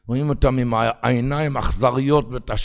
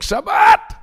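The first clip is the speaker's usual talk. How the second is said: high, loud cry of Shabbat; sing-song shout of Shabbat; 7 dB louder, much slower than usual. Acted. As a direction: call it out like a town crier. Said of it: "ויצא טוב צועק".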